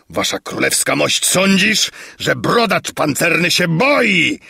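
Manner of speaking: high, boastful challenge; blustering roar. A narrator's reading. Bearded braggart challenging King Padella to the arena, blustering roar. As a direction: roar defiance like a braggart warrior. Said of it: "ryknął okropnym głosem".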